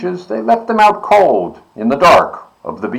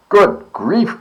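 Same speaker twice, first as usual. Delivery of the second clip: groaned; 10 semitones higher than usual. A narrator's reading. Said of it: "groaned the ones who had stars".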